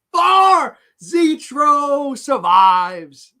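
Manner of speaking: high, loud shout, stretched out at the end; drawn-out, high announcer yell; excited announcing. Real, not acted. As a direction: shout the winner out slowly and loudly. Announcing a correct answer.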